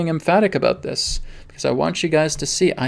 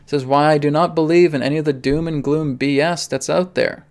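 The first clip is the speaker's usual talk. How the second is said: ordinary talk, nothing marked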